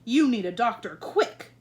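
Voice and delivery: King of Sweden crying out in alarm to the Queen; cried. A narrator's reading. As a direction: urge her to a doctor at once. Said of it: "cried the King".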